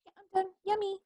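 put-on high, cute little girl voice; toddler voice. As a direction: chirp it like a pleased toddler. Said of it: "she's just like".

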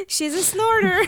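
high, laughing through the words. Real, not acted. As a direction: squeal it out mid-laugh. Laughing fit after the snort.

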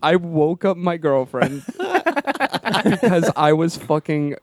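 high, slow words broken by real laughter; cracking up. Real, not acted. Comic confessing about own joke, helpless laughter. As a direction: struggle to speak through laughing.

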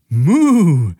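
high, loud delighted cow moo; happy moo. A narrator's reading. The cow Carola dips a hoof, a delighted moo. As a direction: moo with pleasure at the cool water. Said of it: "freute sie sich".

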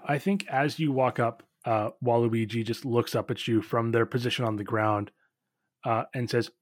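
ordinary delivery

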